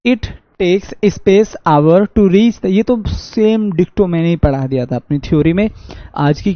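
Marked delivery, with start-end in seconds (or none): 0.0-2.7 reading the sentence out slowly, word by word; drawn-out classroom reading; slow reading aloud. Shown as a demonstration. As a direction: read it like a dictation. Reads quiz sentence aloud.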